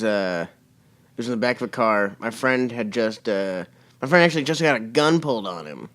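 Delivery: ordinary delivery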